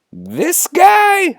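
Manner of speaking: very high, squeaked-up question; incredulous. Acted. Han points at Yoda, wildly incredulous. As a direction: disbelief shooting the pitch sky-high. Han cannot believe Yoda's a Jedi.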